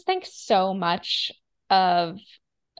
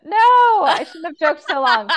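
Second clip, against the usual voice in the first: laughing; 8 semitones higher, 9 dB louder than usual. Real, not acted. Laughing after the bit.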